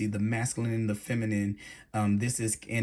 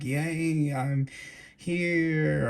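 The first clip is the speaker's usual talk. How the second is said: mock hollow cheer; 6 semitones higher, much slower than usual. Hollow cheer at reaching goal.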